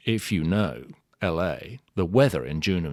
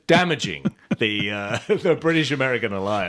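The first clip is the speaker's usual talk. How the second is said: talking through laughter, louder; words bubbling out between laughs; laughing; 4 semitones higher, 4 dB louder than usual. Real, not acted. Apologising for Costner's accent, laughing through it.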